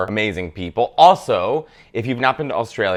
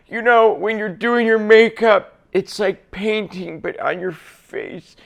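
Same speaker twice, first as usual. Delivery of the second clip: higher, slow, fading into softness; 9 semitones higher, slower than usual. Retold or imitated. Mocking a diva's lines from Burlesque, slow and high. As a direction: drawl it out dreamily, trailing off. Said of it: "Cher was like".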